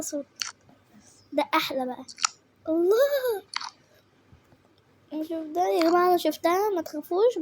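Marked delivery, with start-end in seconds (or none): 2.7-3.4 delighted. Real, not acted. Delighted by the sound again.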